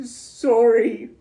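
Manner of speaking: loud high sob; sobbed. A narrator's reading. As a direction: blubber it out. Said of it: "sobbed Hagrid".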